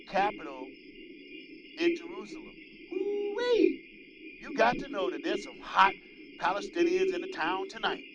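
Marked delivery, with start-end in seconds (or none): 2.9-4.4 ooh-wee. Real, not acted. Gleeful exclamation on air.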